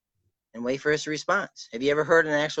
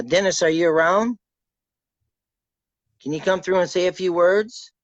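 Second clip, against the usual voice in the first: louder and higher, calling into the empty room; calling out to a spirit; 6 semitones higher, 7 dB louder than usual. Real, not acted. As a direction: summon him, voice raised and reaching.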